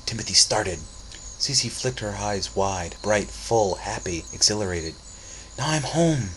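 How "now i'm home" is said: lightly voiced girl. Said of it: "she said".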